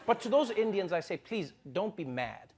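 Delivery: normal talking voice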